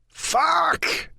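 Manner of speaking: very high furious yell. A narrator's reading. Driver stuck at road construction, furious yell. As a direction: yell it at the roadblock. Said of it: "I yell".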